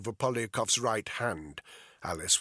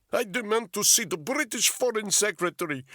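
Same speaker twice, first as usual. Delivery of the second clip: put-on high, loud demand in a Russian accent; outraged Russian captive shouting; 12 semitones higher, 6 dB louder than usual. A narrator's reading. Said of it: "Polyakov shouted".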